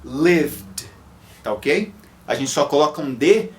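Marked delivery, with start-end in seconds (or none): 0.0-0.9 "lived" as one syllable ending in vd; no vowel before the d in "lived"; correct ending. Shown as a demonstration. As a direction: model "lived" cleanly, ending in vd.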